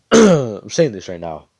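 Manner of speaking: throat cleared, then slow and loud like a boastful rival; imposing rival voice. Retold or imitated. Rival announcing himself to Asta, slow and loud.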